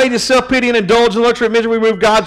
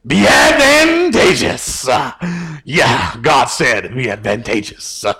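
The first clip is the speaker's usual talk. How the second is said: mock preacher; 6 semitones lower, slower than usual. Pastor spoofing a preaching style for laughs, mock preacher. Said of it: "That's like a preaching word".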